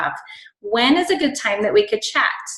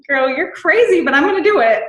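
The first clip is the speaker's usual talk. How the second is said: higher and louder, half laughing at the idea; loud excited exclamation; 7 semitones higher, 4 dB louder than usual. Retold or imitated. To a friend about leaving voice messages, a loud excited squeal. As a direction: squeal it like you can't believe you agreed. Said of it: "I was like".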